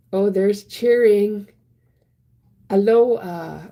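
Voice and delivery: loud, slow, bright hello to the chat; cheerful loud aloha; excited. Real, not acted. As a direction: call out a happy greeting. Greeting cheering viewers.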